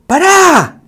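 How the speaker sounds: high, drawn-out cry of disbelief; overwhelmed, high-pitched outburst; stunned exclamation. Real, not acted. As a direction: call a halt, pitch leaping up. Overwhelmed reaction to a video.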